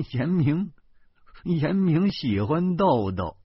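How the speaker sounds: high, awkward chuckle through the words; wry forced laugh. A narrator's reading. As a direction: laugh it off uneasily while explaining. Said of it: "我苦笑着说". (苦笑着解释)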